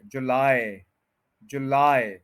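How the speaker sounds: जुलाई as joo-LAAY, falling glide at the end; English pronunciation. Shown as a demonstration. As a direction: close जुलाई on "aay", not ee. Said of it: "ए बोलना है एंड पे". (जुलाई के अंत में "आए")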